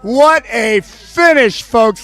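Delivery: high, loud finish shout; long goal yell. Real, not acted. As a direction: roar the finish.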